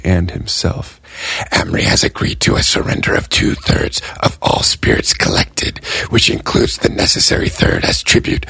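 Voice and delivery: hushed, whispered tally keeper laying out terms; a whispering dealer spelling out a binding bargain; Gray's whispered voice. Acted. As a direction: read the contract in a secretive whisper. Gray reads out the trade terms.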